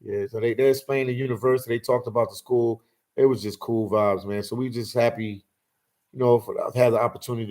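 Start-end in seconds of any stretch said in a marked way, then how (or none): none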